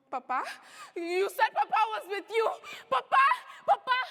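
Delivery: rising panicked cries for Papa; panicked cry. Acted. Girl crying out for her papa, panicked and loud. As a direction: scream for Papa in terror.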